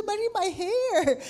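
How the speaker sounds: high, slow panicked cry; shrieking about her hair; alarmed cry. Retold or imitated.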